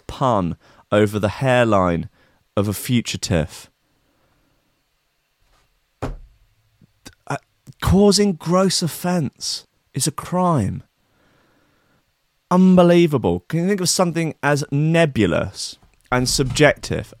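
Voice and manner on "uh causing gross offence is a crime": high, slow, mocking official tone; mock police voice. Vlogger mocking the police's offence statement, high and sneering.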